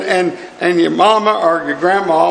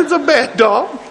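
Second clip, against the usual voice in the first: mock reply; 6 semitones higher than usual. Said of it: "He said".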